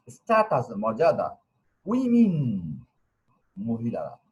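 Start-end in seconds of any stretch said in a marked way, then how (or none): 1.9-2.6 উইমেন said as wi-min; demonstrated pronunciation. Shown as a demonstration. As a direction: say উইমেন high and clear, "ui-min". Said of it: "অনেকে ওমেন বলে".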